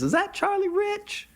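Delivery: matronly woman. Retold or imitated. Gas station woman recognizing Charlie, in a high put-on voice. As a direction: squeal it like a surprised older lady. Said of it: "woman comes out and she goes".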